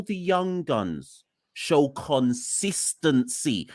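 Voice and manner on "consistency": "consistency" drawn out slowly for emphasis; stressed CONSISTENCY. Real, not acted. Pundit making selectors' key point, stressing "consistency". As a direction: lean hard on "consistency", stretching it out. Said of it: "I'm stressing that word".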